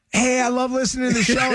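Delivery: high-pitched, gushing listener impression; gushing fan. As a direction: pipe up like an intrusive fan. Voices fan approaching table.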